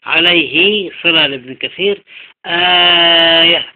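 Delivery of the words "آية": slow, loud, stretched "aaaa-yah" on آية; long badal madd. Shown as a demonstration. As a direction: hold the opening aa of آية very long. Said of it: "بدل للأزرق".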